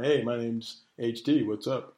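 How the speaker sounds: lightly mocked plain self-introduction; flat mock greeting. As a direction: say it like a dull pickup line. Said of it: "me just going".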